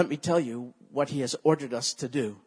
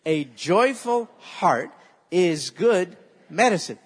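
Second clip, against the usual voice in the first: slow, loud, each word leaned on; a slow chanted recitation for the church to repeat; slow call-and-response; 6 dB louder, slower than usual. Shown as a demonstration. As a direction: lead the congregation line by line. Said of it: "Let's do the two".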